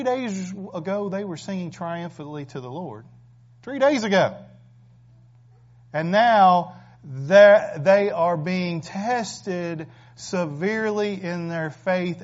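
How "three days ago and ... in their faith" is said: loud, slow, every word leaned on; stretched-out pulpit emphasis; emphatic preaching. Acted. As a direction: hammer it home slowly from the pulpit. Emphatic preaching about the Israelites.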